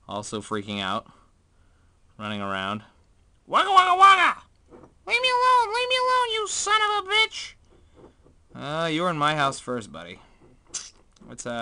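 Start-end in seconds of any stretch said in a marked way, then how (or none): 3.5-7.5 squeaky, much higher, loud; panicking spider voice. Acted. Voicing a trapped spider yelling at the vlogger, squeaky. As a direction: squeal like a frantic tiny spider.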